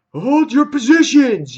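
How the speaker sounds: high, loud mock battle order; shouted command. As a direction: call it out to the troops. Acted commander's order in comic retelling.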